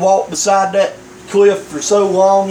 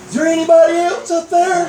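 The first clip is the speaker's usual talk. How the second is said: high-pitched comic holler; calling out; 10 semitones higher, faster than usual. Acted. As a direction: call up hopefully for someone else, as a punchline. Punchline, calling for someone else.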